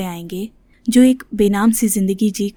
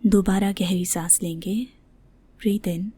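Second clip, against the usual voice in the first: soft, spacious breathing cue; guided meditation; 6 dB quieter than usual. Shown as a demonstration. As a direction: lead the breath softly, with long pauses. (ध्यान कराती धीमी, कोमल आवाज़)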